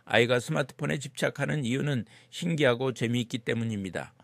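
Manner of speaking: ordinary talk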